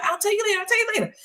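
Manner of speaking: high, urgent imitation; mock a frantic passenger putting off questions; panicked brush-off.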